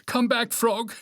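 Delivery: high, pleading call; high-pitched desperate cry; shouted plea. A narrator's reading. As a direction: call a friend back from the dark. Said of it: "he shouted".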